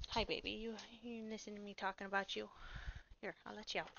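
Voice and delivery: soft cooing words to her pet dog. Real, not acted. Owner turning to greet her dog, soft and cooing. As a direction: coo gently at the dog.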